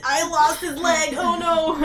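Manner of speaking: high, loud wail through laughter; hammed-up horrified cry, half laughing; mock wail. Voicing Luffy's horrified apology.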